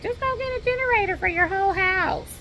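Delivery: high mocking voice of everyone giving advice; mocking know-it-alls. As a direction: toss off the easy advice in a high mock tone. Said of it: "everybody's like".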